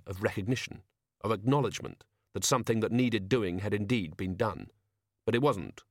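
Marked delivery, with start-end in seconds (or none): none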